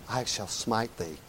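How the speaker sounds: low, soft, slow mock prophet voice; hammed-up pious smiting line; mock biblical prophet. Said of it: "the tone of voice God had in mind".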